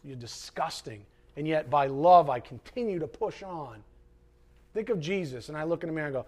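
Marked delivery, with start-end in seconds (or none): 0.0-1.1 low, soft muttered jab; muttered aside. Acted. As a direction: say it under your breath. Aside insult to attackers.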